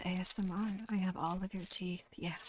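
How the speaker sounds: near-silent creepy ASMR tease; soft ASMR. Pretend. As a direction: breathe it close to the mic.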